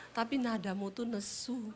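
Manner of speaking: mild soft Javanese husband; soft husband reply. Retold or imitated. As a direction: point it out softly and calmly. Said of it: "Dia jawab".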